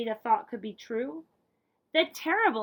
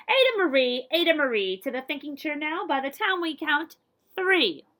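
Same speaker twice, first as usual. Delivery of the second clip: louder, higher stern call; scolding parent; 4 semitones higher, 8 dB louder than usual. Acted. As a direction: call her in like a cross parent.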